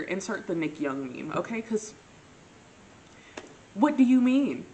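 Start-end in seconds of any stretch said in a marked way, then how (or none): 3.7-4.7 loud, high exasperated question; acted bafflement. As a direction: demand it in exaggerated disbelief. Acts out her bafflement, meme reference.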